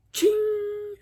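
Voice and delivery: high ringing metallic ching; sudden high chain ring; imitated chain ching. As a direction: mimic a chain snapping taut. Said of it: "I hear him go, or it go".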